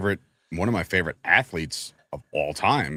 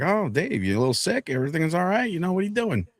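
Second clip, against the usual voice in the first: higher, mock-worried teacher voice; concerned teacher; 10 semitones higher than usual. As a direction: imitate a fussing teacher checking on a student.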